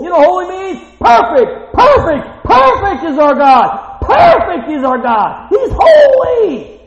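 loud, high, slow hammering praise; full-force preaching. Acted. Preacher praising God before the congregation, full force. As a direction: preach it to the rafters.

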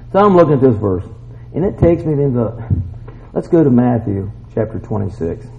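usual speaking voice

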